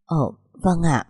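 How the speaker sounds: ngập ngừng, chậm rãi; nói lí nhí như bị bắt quả tang; ấp úng. Acted. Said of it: "ấp úng nói". (ấp úng thừa nhận với mẹ chồng)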